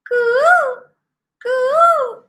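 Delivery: high sung coo like a bird; cuckoo call. A narrator's reading. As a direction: sing out a sweet bird coo. Cuckoo singing heard by bee.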